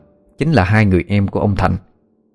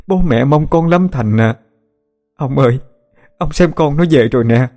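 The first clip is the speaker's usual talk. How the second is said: crying aloud; 7 semitones higher than usual. A narrator's reading. Old mother to her returned son, wailing in high tearful cries. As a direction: cry it out like a mother finding her lost son. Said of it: "rồi bà khóc lớn".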